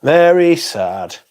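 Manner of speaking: drawn out, high and loud mock sadness; mock sad. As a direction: wail it like fake grief. Mock sad exaggerated repeat.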